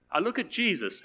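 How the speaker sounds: lifted, joyful. Retold or imitated. Retold believer's words, repeated.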